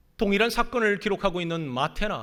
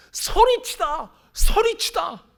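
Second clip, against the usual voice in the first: 소리치다 said slow, much higher and louder; emphatic cry; 14 semitones higher, 10 dB louder, slower than usual. Shown as a demonstration. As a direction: call out 소리치다 twice. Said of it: "emphasizing the word's meaning". (강조하며 외치듯)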